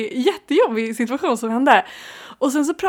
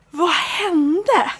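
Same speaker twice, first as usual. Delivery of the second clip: shocked sister; 7 semitones higher, 4 dB louder than usual. Retold or imitated. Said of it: "Alltså chock".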